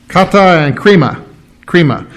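Kata and krima said as Greek, kah-tah and kree-mah; Greek words. Shown as a demonstration. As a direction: sound out krima with a rolled emphasis. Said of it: "it's two words".

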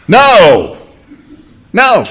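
drawn-out emphatic NO, falling hard; acting out a fed-up parent refusing; frustrated parent's no. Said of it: "parents were totally frustrated and they said".

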